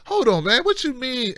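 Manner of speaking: normal talking voice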